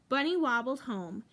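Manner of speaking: ordinary delivery, nothing marked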